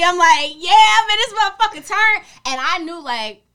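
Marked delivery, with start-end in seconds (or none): 0.0-2.2 loud, high retold party shout; hyped party yell. Retelling her first wild party night, hyped yell. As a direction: holler it over the party.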